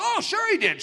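high-pitched protesting comeback; hammed-up defensive retort; mock insistence.

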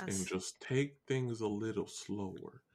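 slow, low, soft drawl; drawn-out, laid-back Southern delivery. Shown as a demonstration. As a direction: take your time, easy and unhurried. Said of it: "you gotta slow down".

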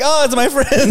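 high laughing grandma voice; lightly voiced grandmother. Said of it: "my grandma comes out like".

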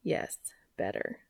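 low murmured reply; low voiced Cass. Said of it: "said Cass".

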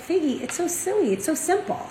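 a hint of a kind teacher waving away a worry; rebbetzin brushing it off. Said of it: "she was like".

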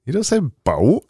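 high mock-posh BOAT. Host mocking co-host's pronunciation, high posh BOAT. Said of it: "Don't put your posh voice on".

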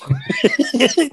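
high chuckling laugh; amused high-pitched giggle. Real, not acted. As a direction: chuckle at your own story, high. Speaker laughs at own story.

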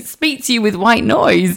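normal talking voice

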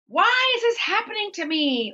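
exaggerated woe-is-me protest; self-pitying complaint. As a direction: wail it high, hamming up the self-pity. Acts out self-pitying human nature.

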